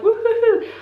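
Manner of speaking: high gleeful woohoo with a laugh; a giddy little cheer; excited whoop. Real, not acted. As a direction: whoop it up, giggling. Hair styled, feeling great.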